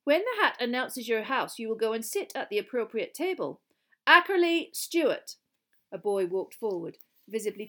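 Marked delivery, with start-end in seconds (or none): 0.0-5.4 raised formal voice, building to a called-out name; formal roll-call, higher and projected; teacher's announcement. A narrator's reading. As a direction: instruct the new pupils, then call the name loudly. Teacher addressing hall, calling roll.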